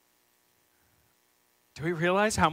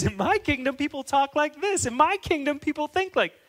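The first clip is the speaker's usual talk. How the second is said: higher, louder, bossy ranting king; inner tyrant voice; 8 semitones higher, 6 dB louder than usual. Retold or imitated. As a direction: rant like a self-made king who owns the roads. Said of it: "we start telling".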